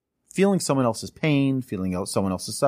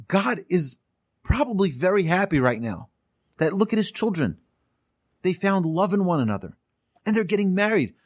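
moved joy; 7 semitones higher than usual. Retold or imitated. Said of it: "He's just like".

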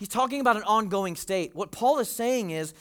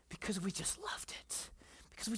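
whisper; 15 dB quieter than usual. Real, not acted. Tender aside about the kids.

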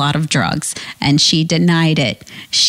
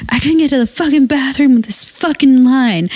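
higher, irritated complaining patient; rude patient voice; 7 semitones higher than usual. Retold or imitated. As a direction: whine like a fed-up patient. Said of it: "this is how she spoke to me".

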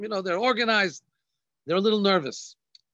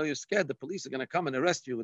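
ordinary talk

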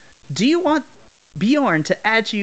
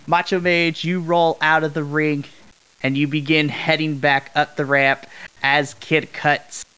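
ordinary delivery

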